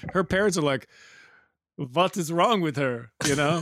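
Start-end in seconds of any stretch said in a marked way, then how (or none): 1.8-3.1 slow, put-on Russian parent voice; slow comic Russian accent; mock Russian accent. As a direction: imitate exasperated parents in a thick Russian accent. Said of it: "has an accent in that as a Russian".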